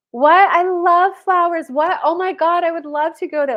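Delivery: high, loud, breathless gush; gushing excitement. Retold or imitated. Young woman gushing at a suitor's offers, excited and high. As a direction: squeal it like everything thrills you. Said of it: "bursting at the seams with the excitement".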